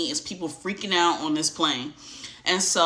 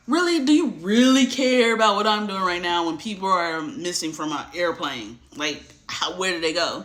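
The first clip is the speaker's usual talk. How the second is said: annoyed passenger snapping at the flight attendant; indignant outburst. Retold or imitated. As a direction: scold him as if he's lost his mind. She's been a Karen.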